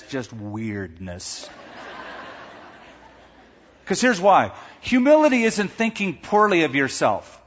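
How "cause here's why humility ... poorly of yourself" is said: ordinary talk, nothing marked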